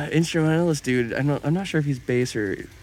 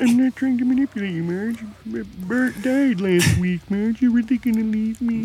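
Homer Simpson; 9 semitones higher than usual. Acted. Grieving husband begging his wife, hammed-up sadness. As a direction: plead like a mock-heartbroken cartoon husband. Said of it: "just, like, really sad".

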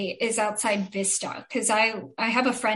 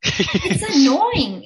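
drawn-out annoyance; 3 semitones higher, 6 dB louder, much slower than usual. Real, not acted. Complaining about chatty baristas.

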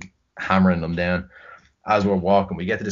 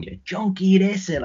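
higher mock drunk insult; abusive texts voiced; 12 semitones higher than usual. Drunk coworker insulting him by text, higher mock voice. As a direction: sneer the insult in her voice. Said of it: "calling me every name under the sun".

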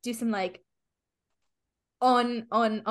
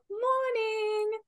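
high, drawn-out cheery greeting; bright sing-out morning hello; 12 semitones higher than usual. Acted. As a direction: chirp it sweetly, held long on a high note. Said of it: "very cheery".

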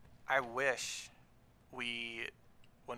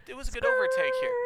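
excited race call; 10 dB louder, faster than usual. Acted.

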